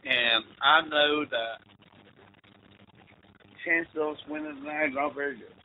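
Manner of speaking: a touch of solemn prayer, hushed; mock solemn priest prayer. Mocking the priest's pregame prayer.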